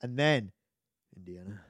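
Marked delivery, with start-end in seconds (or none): 1.1-1.6 low, very soft calling of a name; calm. Retold or imitated. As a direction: say it gently, low and hushed.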